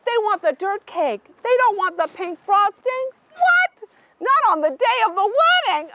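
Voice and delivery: usual speaking voice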